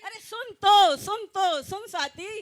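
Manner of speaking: loud, high call to get her attention; mock alarmed shouting. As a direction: shout for Swati to listen. Mother-in-law calls daughter-in-law.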